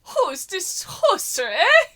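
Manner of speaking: mock Canadian "eh?"; lightly put-on Canadian voice ending "eh?". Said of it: "Is he supposed to be Canadian?".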